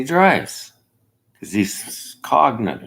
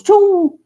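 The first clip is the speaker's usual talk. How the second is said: sudden high loud whoosh; explosive mock sound effect; whoosh noise; 10 dB louder than usual. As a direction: make the trap door drop. Trap door sound effect.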